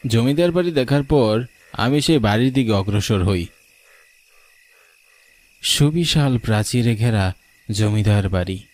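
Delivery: ordinary delivery, nothing marked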